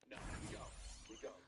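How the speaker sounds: ordinary delivery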